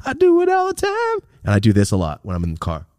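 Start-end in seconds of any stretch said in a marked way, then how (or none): 0.1-1.3 I do it all the time in a squeaky falsetto; squeaky high. Shown as a demonstration. Admitting a car habit to the audience, squeaky falsetto.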